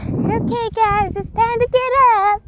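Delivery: high-pitched mock dad voice; exaggerated high parental rousing; dad's wake-up call. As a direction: imitate an annoyingly chirpy wake-up call. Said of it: "he'd say it in that tone of voice".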